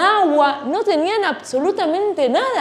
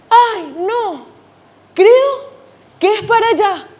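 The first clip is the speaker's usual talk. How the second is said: higher and louder, creo jumping up; more worried dad; 6 semitones higher, 5 dB louder, slower than usual. Acted. Lost dad guessing again, higher and louder. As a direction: fluster the repeat, voice climbing on creo. Dad reads map, second time.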